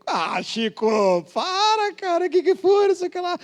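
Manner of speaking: hammed-up nervous plea to a friend; mock panic. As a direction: beg your friend to stop, voice climbing.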